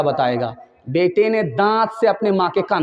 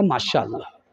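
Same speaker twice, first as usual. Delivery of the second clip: lower, softer, satisfied murmur; content murmur; 8 semitones lower, 6 dB quieter than usual. Acted. Daughter telling her mother about her in-laws, soft and content. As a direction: answer it quietly, pleased.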